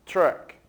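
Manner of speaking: truck said the English way, for students to repeat. Shown as a demonstration. Teacher models truck for students to repeat, in English pronunciation. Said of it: "Mais uma vez".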